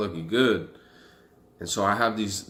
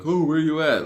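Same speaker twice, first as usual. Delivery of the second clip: higher, louder mocking whine; mocking the unit calling about him, higher and louder; 8 semitones higher, 7 dB louder than usual. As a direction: parody their fake concern. Mocking the unit's phone calls.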